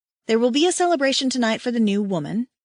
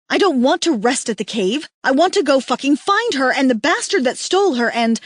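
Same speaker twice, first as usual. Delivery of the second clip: loud, higher angry tirade; furious rant; 4 semitones higher, 5 dB louder than usual. A narrator's reading. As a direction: rage at them, demanding action. Stranger woman rants angrily.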